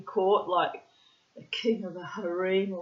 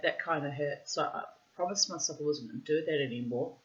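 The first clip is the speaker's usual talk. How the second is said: usual speaking voice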